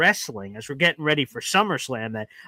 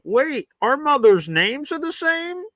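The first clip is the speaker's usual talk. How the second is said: high, slow mock-sincere realization; high mocking reenactment of the heroes' sudden friendship; mock movie heroes; 11 semitones higher, slower than usual. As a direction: ham up the superheroes bonding. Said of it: "they're like".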